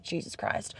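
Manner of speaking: under-the-breath exasperated whisper; whispered exasperation; whispered aside. Retold or imitated. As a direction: hiss it to yourself in disbelief. Muttered reaction to covid-scared skier.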